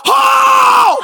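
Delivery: a huge, very high shout of joy. Real, not acted. Preacher who warned the church he would shout, a very high loud cry. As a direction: let it burst out at full voice. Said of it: "you cannot blame me if I shout".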